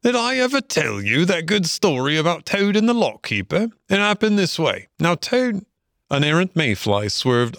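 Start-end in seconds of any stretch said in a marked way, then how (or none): 0.0-5.6 high-pitched otter launching an anecdote; storytelling Otter. Acted. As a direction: eagerly set up a tale, pitched high.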